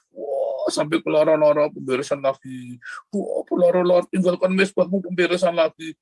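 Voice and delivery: high, loud imitation of tearful pleading; mock anguished wailing. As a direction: exaggerate someone wailing in agony, mockingly. Mocking people wailing through repeated sessions.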